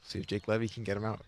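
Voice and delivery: low hushed golf commentary; whisper-soft tournament call. Pretend. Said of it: "stop this golf voice".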